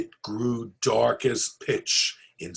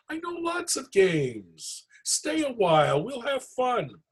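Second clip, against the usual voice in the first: pleading; 11 semitones higher than usual. Acted. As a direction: beg him kindly not to leave. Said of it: "Herschel pleaded".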